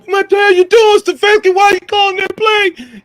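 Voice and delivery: loud, high mock tirade at the coach; angry fan yelling. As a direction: yell it out like an outraged fan.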